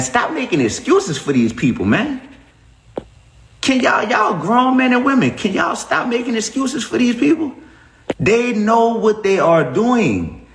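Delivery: high-pitched, exasperated appeal; exasperated plea. Real, not acted. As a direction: plead with them in rising, high-pitched frustration. Scolding viewers for making excuses.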